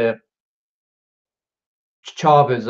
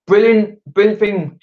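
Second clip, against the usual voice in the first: high, loud brilliant; loud, high-pitched real enthusiasm; excited praise; 6 semitones higher, 4 dB louder than usual. Real, not acted. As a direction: burst out with real delight. Praising viewer's idea.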